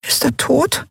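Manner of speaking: tiny high squeak. A narrator's reading. Fairy asking if emperor is dead, squeaky. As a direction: chirp the question like a small bird. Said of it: "piepste Nelen".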